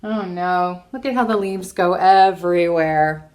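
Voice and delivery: ordinary delivery, nothing marked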